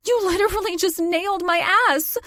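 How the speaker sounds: a half-laughing, wincing outcry; crying out, half laughing. A narrator's reading. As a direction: laugh through the pain. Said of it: "I cried, half laughing, half wincing".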